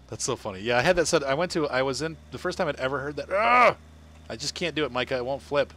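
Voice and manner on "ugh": loud, high "ugh" of frustration; frustrated groan. Real, not acted. Failing to flip it in game, frustrated groan. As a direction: burst out a real exasperated grunt.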